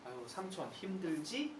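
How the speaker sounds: comforting the uncle softly; gentle comforting. Retold or imitated. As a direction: pat him and soothe him softly. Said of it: "이렇게 얘기하는 거예요".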